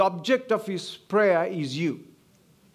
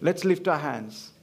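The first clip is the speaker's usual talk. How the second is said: normal talking voice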